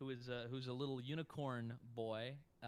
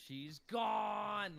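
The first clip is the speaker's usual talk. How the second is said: higher, louder mock scream; imitating a scream; 9 semitones higher, 5 dB louder than usual. Fan imitating Steven's scream from a cartoon scene. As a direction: yell it like the cartoon kid. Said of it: "Like, he screams it".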